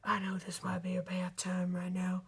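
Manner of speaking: low, soft secret whisper. Acted. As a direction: lean in and whisper it. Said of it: "Mater whispered".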